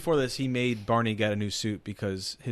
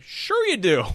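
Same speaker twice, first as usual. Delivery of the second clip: higher, louder mock comeback; cocky retort; 11 semitones higher, 7 dB louder, slower than usual. Imitating the movie tipper answering the FBI man, cocky retort. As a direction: toss back a loud, high-pitched retort.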